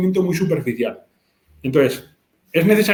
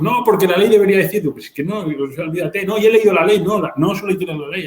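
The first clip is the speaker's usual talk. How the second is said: higher, insistent mock protesting; retold heated argument; 5 semitones higher than usual. Retelling old disputes with computer specialists over the law, mock-argumentative. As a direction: re-enact a back-and-forth dispute, pitched up.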